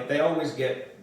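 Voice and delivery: plain reading voice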